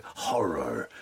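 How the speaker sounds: hushed, drawn-out American cinema voiceover; American trailer voice. Acted. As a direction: intone it like an American horror trailer. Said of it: "that kind of Ameri-, American voice".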